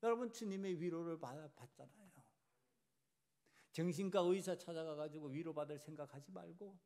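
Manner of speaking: ordinary delivery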